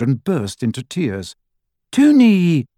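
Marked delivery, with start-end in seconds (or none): 1.9-2.7 very high, loud frightened call; alarmed cry. A narrator's reading. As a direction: cry out as her sister is hurt. Sister hit by branch.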